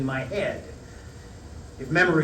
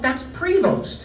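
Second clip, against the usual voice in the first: sudden realization; 13 semitones higher, slower than usual. Retold or imitated. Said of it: "I thought".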